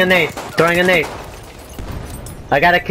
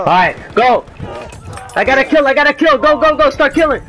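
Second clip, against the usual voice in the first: excited shouting; 10 semitones higher than usual. Real, not acted. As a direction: shout it over the gunfire. Charging with bayonet, yelling.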